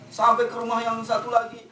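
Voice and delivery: plain reading voice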